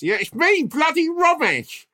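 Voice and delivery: high, slow put-on Romesh voice; squeaky caricature of a mate; Romesh impression. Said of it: "my voice is getting worse".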